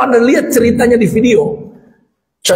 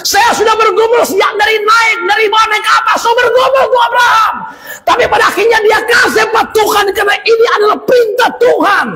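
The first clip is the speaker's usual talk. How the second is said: anguished cry; 10 semitones higher than usual. Acted. Abraham to his son on the altar, high tearful shouting. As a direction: cry out the apology through tears.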